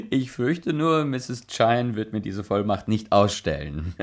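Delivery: ordinary delivery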